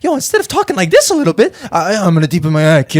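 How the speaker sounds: put-on deep movie-star voice, hammed up; mock a tough guy forcing his voice down; Mark Wahlberg forced deep voice. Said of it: "He did a bit of a voice".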